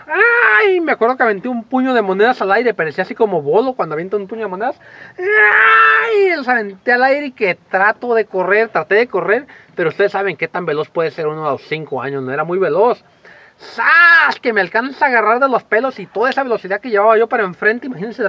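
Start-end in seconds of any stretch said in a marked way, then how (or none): 0.1-0.8 very high, loud startled yelp; child's shriek. Retold or imitated. Kid caught, screams. 5.2-6.4 very high, long panicked yelp; child's shriek. Retold or imitated. Kid throws coins, screams. 13.6-14.4 high loud imitated whack; sharp smack sound. Sound of the hair grab.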